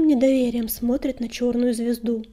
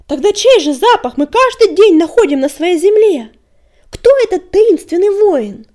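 furious yell, higher and louder; 7 semitones higher, 13 dB louder than usual. A narrator's reading. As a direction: bellow it across the clearing. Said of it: "заорал Метеор".

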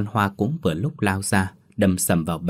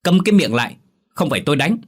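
much higher, louder furious bark; loud shout; 7 semitones higher, 5 dB louder, faster than usual. A narrator's reading. Father shouts at nagging wife, loud. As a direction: bellow her into silence. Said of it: "cố kìm lại quát lớn".

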